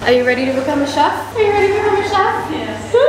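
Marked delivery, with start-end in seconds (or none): 1.4-2.5 higher, fast, pumped-up ask; hyped-up repeat. Shown as a demonstration. Hyping her friend up for the cooking class. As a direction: hype it like a host.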